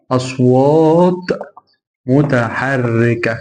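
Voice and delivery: very slow, stretched teaching delivery; slow emphatic drill. Shown as a demonstration. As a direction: draw each word right out, syllable by syllable. Teacher drills the key term.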